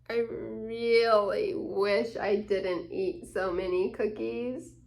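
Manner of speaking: ordinary delivery, nothing marked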